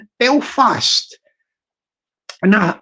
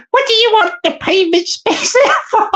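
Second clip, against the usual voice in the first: squeaky-high question through laughter; trying to stay in character while laughing; cracking up; 10 semitones higher than usual. Acted. Council woman asking about pavement space, cracking up with laughter.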